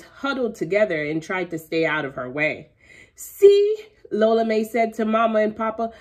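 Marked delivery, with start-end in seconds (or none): 3.1-3.8 put-on high, loud excited girl. A narrator's reading. Girl showing off her chicken to mama and papa. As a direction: burst out proudly.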